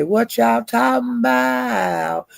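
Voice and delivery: high, slow, drawn-out mock question; captain coming off mute. Captain unmutes mid-argument, high slow mock innocence. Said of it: "he come off of mute and he say".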